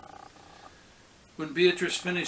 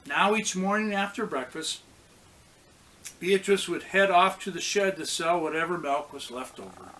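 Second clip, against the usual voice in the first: ordinary delivery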